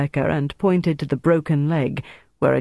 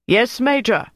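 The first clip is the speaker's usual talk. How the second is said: calling back; 8 semitones higher, 3 dB louder than usual. A narrator's reading. Sergeant answers officer's call.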